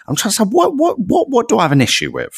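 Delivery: high, fast muttering to himself; high searching voice. Real, not acted. Podcaster hunting through notes for a criticism, high and rushed. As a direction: pitch up and rush it, hunting for a flaw. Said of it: "I'm just trying to sit".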